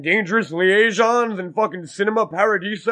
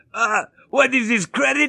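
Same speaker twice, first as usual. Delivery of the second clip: higher, put-on alien junk dealer voice; mock the slave owner's voice; hammed-up Watto voice; Watto impression; 6 semitones higher than usual. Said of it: "you mean this?".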